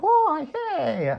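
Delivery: high, drawn-out cartoon diva voice. Friend showing the singer from the play, cartoon diva voice. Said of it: "so essentially this voice, but kind of up to a ten".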